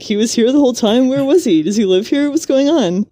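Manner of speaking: hammed-up panic at a man popping up; mock freak-out. Mocking Anna's panic at Taylor appearing, a fast high mock freak-out. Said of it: "Anna freaks out".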